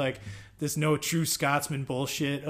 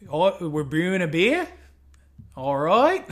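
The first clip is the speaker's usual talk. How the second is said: exaggerated rising Aussie inflections; mock Australian accent; 3 semitones higher, 4 dB louder than usual. Shown as a demonstration. Showing the weird Aussie inflections, mock Australian accent. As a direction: swoop up at each end, mock Australian. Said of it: "they have weird inflections".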